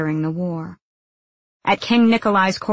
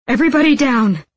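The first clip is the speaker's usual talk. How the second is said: high, loud warning to the group; raised, commanding call; urgent instruction; 7 semitones higher, 7 dB louder than usual. A narrator's reading. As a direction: order everyone to take cover. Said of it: "he instructed".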